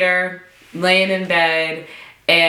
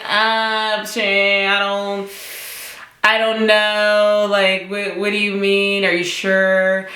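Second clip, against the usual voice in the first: reluctant and unsure, pitched up; hesitant; 4 semitones higher than usual. Retold or imitated. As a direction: stall doubtfully, dragging out the first words. Acting out her unsure reaction to advice.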